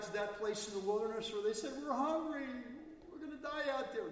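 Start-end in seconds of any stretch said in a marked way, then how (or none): 1.8-4.1 high, loud complaining cry; whining Israelites. Acted. Preacher voices the hungry people, high and loud. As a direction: whine it like a desperate crowd.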